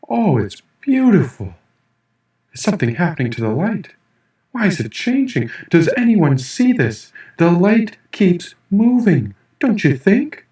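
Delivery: high, breathless amazement at the light; dazzled, voice lifted high; awestruck wonder. Acted. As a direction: gasp out the wonder like a child seeing magic. Narrator awestruck on mushroom trip.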